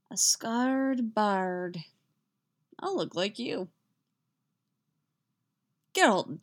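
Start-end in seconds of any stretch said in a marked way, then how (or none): 0.1-3.7 slow, dazed musing; dreamy. A narrator's reading. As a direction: stretch it out absently, as if woozy. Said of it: "still in that dreamy, absent voice".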